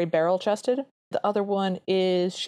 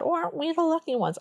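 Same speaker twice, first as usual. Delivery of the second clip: put-on Brooklyn accent; 9 semitones higher, faster than usual. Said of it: "talking in their Brooklyn accents".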